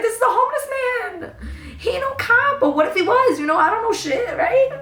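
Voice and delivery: very high, squeaky-pitched disbelief; hammed-up high-pitched exasperation; exasperated protest. Exasperated reply to paranoid friend.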